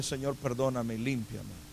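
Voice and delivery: low, hushed plea for forgiveness; humble murmured confession; penitent prayer. Retold or imitated. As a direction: pray it softly, dropping right down. Confesses sin in acted prayer.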